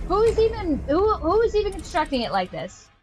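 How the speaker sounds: high-pitched, incredulous ranting; exasperated, high-pitched complaint; exasperated outburst. Real, not acted. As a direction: demand it in real disbelief, voice shooting up.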